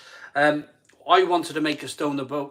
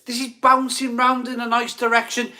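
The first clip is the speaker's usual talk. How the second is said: high, loud excited hype; excited announcement; 10 semitones higher, 5 dB louder than usual. Real, not acted. Host hyping the live stream's audience count, excited and loud. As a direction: crow it out to the whole crowd, pitched way up.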